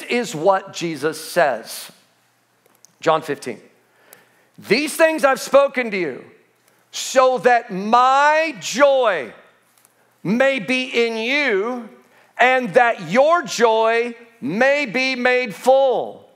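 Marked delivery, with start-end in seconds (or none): 4.6-16.3 higher, slow, emphatic scripture reading; raised, drawn-out sermon delivery; preached scripture reading. Acted. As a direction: declaim the verse slowly, stretching key words.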